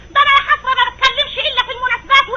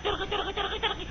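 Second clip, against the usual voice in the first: trailing off much softer; mocking repetition; 2 semitones lower, 15 dB quieter than usual. Wife mocking her husband's chatter, fading softer. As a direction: let each "ترغي" fade away mockingly.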